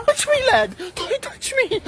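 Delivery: very high panicked squeal; shrieking victim. Acted.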